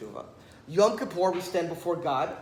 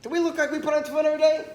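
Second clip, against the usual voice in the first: fast, higher, incredulous scoff; scoffing retort; 8 semitones higher, 6 dB louder, much faster than usual. Retold or imitated. Guard scoffing at the rabbi, fast incredulous retort. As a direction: toss it back as if absurd.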